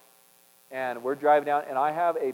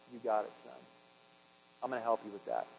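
gentle reassurance; 4 semitones lower, 10 dB quieter, faster than usual. Retold or imitated. God's reply voiced gently.

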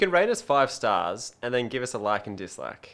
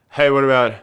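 loud, blurting random-guy voice; Damo voice; 7 dB louder than usual. Retold or imitated. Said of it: "Is that a pretty good Damo impersonation".

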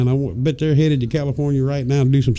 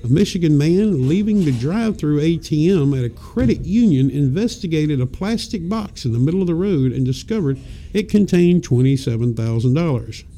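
ordinary delivery

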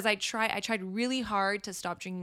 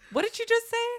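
very high-pitched disbelief; exaggerated high astonished question; mock startled viewer. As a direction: squeak it out as a hammed-up double take. Acts out startled viewers after rewinding.

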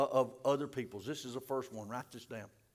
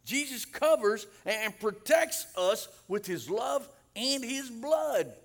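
high, loud, slow preacher's proclamation; ringing high pulpit shout; full-force preaching; 10 semitones higher, 8 dB louder, slower than usual. Real, not acted. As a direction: belt it out to the congregation, stretching each phrase.

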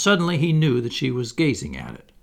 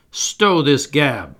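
slow, loud pirate roar; 4 semitones higher, 6 dB louder, much slower than usual. A narrator's reading. Pirate captain silencing the boys, roaring. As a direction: cut them off with a drawn-out roar. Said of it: "roared Hook".

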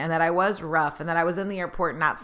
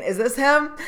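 higher, urgent question; higher, worried asking; 7 semitones higher, 5 dB louder than usual. Retold or imitated. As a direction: ask it anxiously, hoping. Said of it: "people were picking them up and saying".

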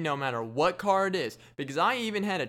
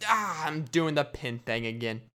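ordinary talk, nothing marked

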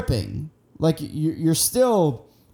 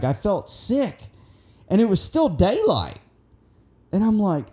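normal talking voice

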